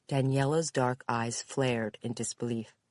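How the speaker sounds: ordinary delivery, nothing marked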